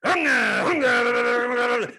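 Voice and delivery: loud, high rumbling engine noise; shouted mock engine rumble; engine roar. Car engine noise imitated.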